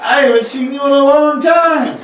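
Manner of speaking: high, laughing monster greeting; cuddly blob monster. Acted. Tentacle monster greeting the boy it hugs, laughing and high. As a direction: squeal it while hugging.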